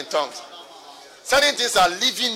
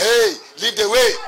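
bold command; 4 semitones higher, 7 dB louder, slower than usual. Pretend. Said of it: "Faith says".

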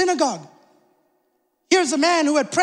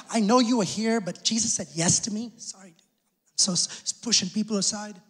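softly muttered excuses, much softer; hushed apology; 6 semitones lower, 6 dB quieter than usual. Retold or imitated. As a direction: mumble sorry while pushing past. Jairus pushing through crowd.

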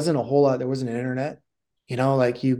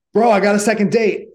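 imitating an elated guy, high and loud; excited news; 7 semitones higher, 7 dB louder than usual. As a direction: announce your triumph to a buddy. Man reports his success excitedly.

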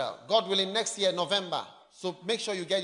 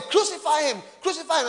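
crowd cry; 5 semitones higher, 4 dB louder than usual. Acted. Crowd crying for crucifixion.

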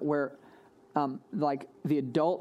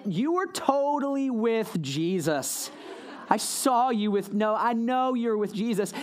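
put-on slow, drawn-out teenage girl voice, higher; valley girl teen; 5 semitones higher, slower than usual. Preacher voices a teen girl accusing Peter, slow and high. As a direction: play a teen in Ugg boots, dragging the words out. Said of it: "and she's like".

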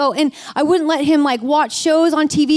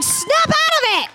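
high, drawn-out mock yell; exasperated husband; slower than usual. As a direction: imitate a husband snapping someone out of it, slowly. Husband douses her, acted quote.

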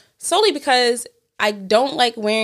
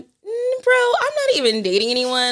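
incredulous retort; 12 semitones higher, slower than usual. Retold or imitated. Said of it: "I'm like".

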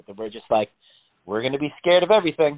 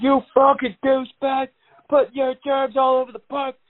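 high, furious ranting yell; ballistic rant; 12 semitones higher, 3 dB louder than usual. Retold or imitated. Imitating a homeless man yelling at a snotting jogger, high furious rant. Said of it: "the homeless guy goes ballistic".

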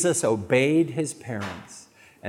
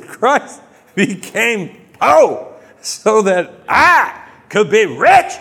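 loud, slow, higher mock televangelist; preacher impression; 5 semitones higher, 6 dB louder, slower than usual. Quoting Creflo Dollar to the crowd, slow mock preacher. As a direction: drawl it out like a greedy preacher. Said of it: "And he said".

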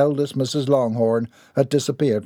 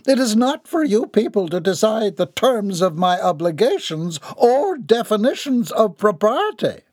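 high, imperious dowager voice; haughty old Baroness, indignant; 9 semitones higher than usual. A narrator's reading. Baroness rebuking her son's party on the stairs, haughty and indignant. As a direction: lecture them grandly in a raised pitch.

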